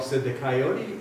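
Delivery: ordinary delivery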